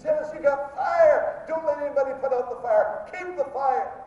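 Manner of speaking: high, loud, rousing exhortation; fired-up shout. Retold or imitated. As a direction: shout it like a revival charge. Fired-up charge to the preacher.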